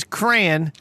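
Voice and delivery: the wrong crayon, one syllable; crayon squashed to "cran". Shown as a demonstration. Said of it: "is completely wrong".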